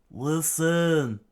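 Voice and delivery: high, loud drawn-out plea; screaming out. Acted. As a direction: shout to be heard over the storm. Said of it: "able to scream out loud".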